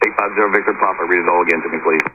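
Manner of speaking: ordinary talk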